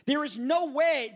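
ordinary talk

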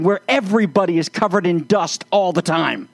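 usual speaking voice

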